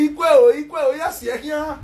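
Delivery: lightly mimicked high-pitched caller; mimicked calling out. Retelling what the guy said, mock calling out.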